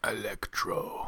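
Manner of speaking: slow hushed whisper; slow dramatic whisper of Electro; whispered name. Real, not acted. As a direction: breathe the name slowly.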